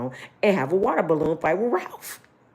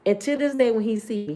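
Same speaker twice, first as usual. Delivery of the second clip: ordinary talk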